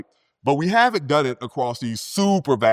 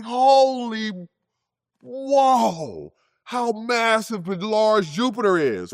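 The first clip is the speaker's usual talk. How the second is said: awestruck; 9 semitones higher, slower than usual. Retold or imitated. Awed at Jupiter's size.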